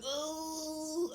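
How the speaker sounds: long high wailing groan; hammed-up drawn-out cry of pain; mock labor moan. Mother moaning in labor, acted.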